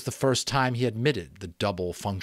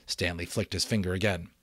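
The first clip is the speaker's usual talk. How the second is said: ordinary delivery, nothing marked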